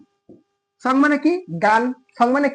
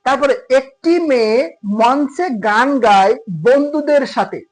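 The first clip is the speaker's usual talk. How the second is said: slow, each word spaced out; slow dictation; slower than usual. Shown as a demonstration. Teacher dictating a practice sentence to students, slowly. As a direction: read it out slowly for the class.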